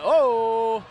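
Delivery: loud sudden oh at the catch; surprised shout. Real, not acted. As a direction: gasp it out loud. Great catch.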